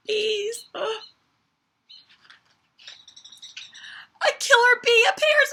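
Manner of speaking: high, loud alarmed shout. Acted. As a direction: shout it out as the bee strikes. Climax, killer bee appears.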